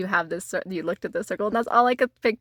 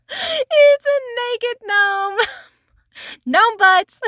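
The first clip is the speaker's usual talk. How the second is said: laughing; 13 semitones higher, 5 dB louder, much slower than usual. Real, not acted. Cracking up at gnome card.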